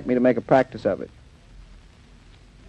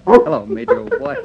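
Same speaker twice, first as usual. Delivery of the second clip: doggy talk; 13 semitones higher than usual. Acted. Greets the dog in pet voice.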